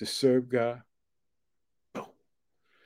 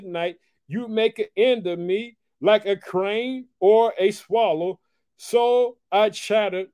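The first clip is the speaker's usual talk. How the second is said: higher, slow, emphatic pulpit reading; preached scripture; 8 semitones higher, 4 dB louder, slower than usual. Acted. Preacher reads the psalm of Hezekiah aloud, slow and preached. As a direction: draw out every word of the lament.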